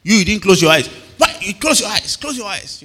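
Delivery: high-pitched mock scolding of pupils; scolding teacher. Sunday school teacher catches children with open eyes, high mock scolding. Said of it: "And then the teacher is looking".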